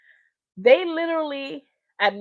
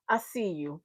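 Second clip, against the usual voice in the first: low, soft acknowledgement; imitated cool rapper; 6 semitones lower, 10 dB quieter than usual. Rapper acknowledging screaming fans, imitated low-key cool. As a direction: say it quietly, unimpressed by the noise. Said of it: "then he's like".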